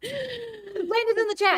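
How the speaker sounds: high sing-song. Acted. Greeting Landon in high voice.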